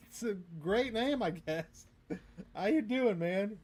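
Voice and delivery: high, slow, chuckling teasing; laughing mock praise. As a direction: tease him while laughing.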